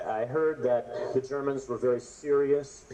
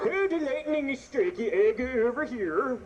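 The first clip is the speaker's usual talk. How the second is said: mock statue voice; 9 semitones higher than usual. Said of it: "It's like".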